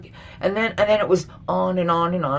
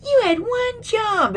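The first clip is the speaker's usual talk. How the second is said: high, slow mock of a furious kid; shrill-pitched, drawn-out whine of an upset teen; angry kid; slower than usual. Mocking the kid who filmed himself. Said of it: "There's this one kid, he had".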